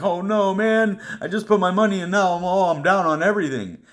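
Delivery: high, fretful imitation of his early self; mock worried newbie. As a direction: whine like a scared first-time investor. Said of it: "I'm like".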